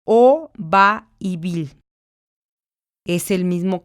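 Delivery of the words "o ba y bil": slow, each syllable set apart and spoken separately; split into syllables. Shown as a demonstration. Teacher to students, sounding out syllables slowly. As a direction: spell out the syllables slowly for the class. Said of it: "Entonces sería".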